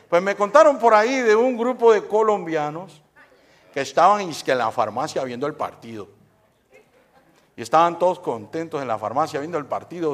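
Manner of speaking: ordinary talk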